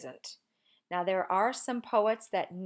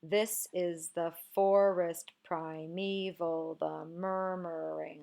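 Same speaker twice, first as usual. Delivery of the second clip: slow scansion, leaning on THIS, FOR in forest, ME in primeval; slow metrical reading, stress then two light syllables; stressed first syllables; much slower than usual. Shown as a demonstration. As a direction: draw out the beats on "This", "FORest", "priMEval", "MURmuring". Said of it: "the poem begins with a stressed".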